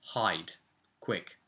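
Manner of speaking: normal talking voice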